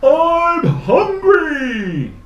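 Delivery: very high, loud, long-drawn bellow; hungry monster shout. Acted. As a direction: stretch each word into a huge yell. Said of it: "he shouted".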